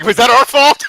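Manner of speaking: high, loud mock-alarmed question. Player joking about being to blame, mock alarm. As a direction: blurt it out in laughing mock panic. Quoting own guilty first thought.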